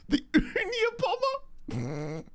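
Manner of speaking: high squeak on the pun, then fading; squeaky laughing repeat. Real, not acted. Repeating the pun to viewers with a high laughing squeak.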